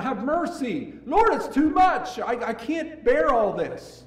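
very high, loud pleading cry; desperate cry. Retold or imitated. Believer crying to God in trouble, high desperate plea. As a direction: cry out as if overwhelmed. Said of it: "we cry out".

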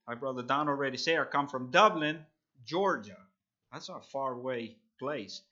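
normal talking voice

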